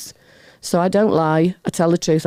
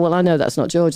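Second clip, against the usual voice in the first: ordinary talk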